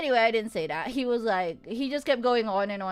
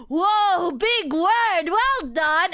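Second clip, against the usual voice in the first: mocking, loud and high congratulations; hammed-up condescending well done; sarcastic praise; 7 semitones higher, 5 dB louder, much slower than usual. Mocking a drunk guy's big word, loud sarcastic praise. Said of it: "and it started clapping".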